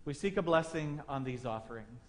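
soft, hushed prayer voice. Acted. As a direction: pray quietly before the congregation. Pastor leads a prayer.

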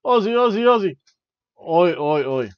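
loud, high hammed-up Australian lads, chanting at the end; the jobber team mocking the Street Profits in a loud mock Australian accent. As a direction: shout it like rowdy Aussie fans in a mock Australian accent. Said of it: "They're like".

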